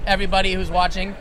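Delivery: usual speaking voice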